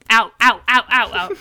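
loud, rapid-fire mock chant; urgent. Retold urgent cries to eject him.

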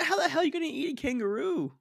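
high-pitched, near-cracking laugh through the words; real incredulous laughing question; laughing disbelief. Real, not acted. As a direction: squeal it out in disbelief. Incredulous about kangaroo-eating beast.

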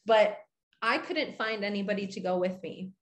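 usual speaking voice

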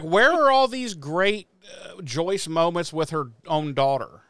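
loud, high disbelief at Joyce; incredulous outburst. Retold or imitated. Host recalling his loud, high disbelief while watching. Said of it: "I was like".

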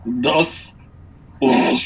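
normal talking voice

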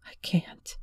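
whispered through tears; hushed, tearful plea. A narrator's reading. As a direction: barely get the words out, crying. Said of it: "breaking into tears instead".

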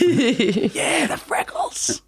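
high airy swoon. Pretend. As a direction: sigh it out like a smitten fan. Swooning over freckles.

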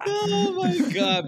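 high, slow drawn-out oh my God; amazed oh my God. Real, not acted. Laughing at the phone swap, a slow high oh my God. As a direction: stretch it out in disbelief.

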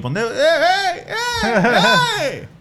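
very high, slow wailing cry after her; mock wailing call. He wails after his girlfriend walking off in the rain, very high mock cry. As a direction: wail after her like a lost child.

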